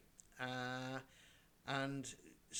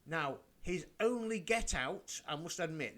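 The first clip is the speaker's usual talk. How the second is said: ordinary talk, nothing marked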